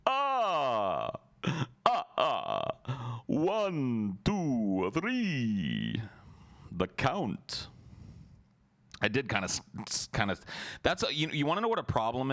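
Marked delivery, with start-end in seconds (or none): 0.1-7.7 high, swooping cartoon vampire counting with drawn-out words; theatrical counting with a long ah-ah-ah laugh. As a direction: count grandly, each word arcing up and falling away. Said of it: "started to sound like the Count".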